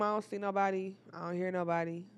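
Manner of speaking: ordinary delivery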